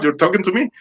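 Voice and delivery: high-pitched incredulous question; retold disbelief. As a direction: ask it in disbelief, pitch jumping up.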